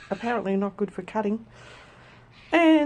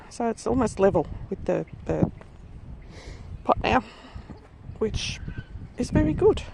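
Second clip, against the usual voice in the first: ordinary talk, nothing marked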